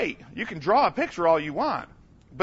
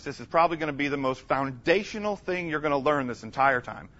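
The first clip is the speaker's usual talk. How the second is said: ordinary talk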